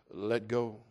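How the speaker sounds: low, quiet divine command; voice from heaven. Acted. God telling man to release the branch, voice from heaven. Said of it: "a voice comes from heaven".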